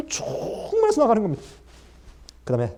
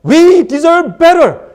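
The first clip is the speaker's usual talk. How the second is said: loud, slow, high "We deserve better"; entitled demand; 8 semitones higher, 11 dB louder, much slower than usual. Acted. Preacher voicing the first-hired workers demanding more, loud and slow. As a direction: stretch it out, smug and entitled.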